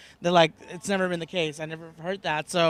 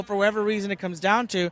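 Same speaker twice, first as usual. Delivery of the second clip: normal talking voice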